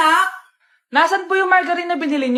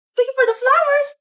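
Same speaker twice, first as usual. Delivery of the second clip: TikTok NPC live; 7 semitones higher than usual. Pretend. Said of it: "yung A I na trend sa TikTok".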